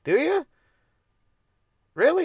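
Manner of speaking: high incredulous mockery; mock disbelief. Mocking the fake gun bluff.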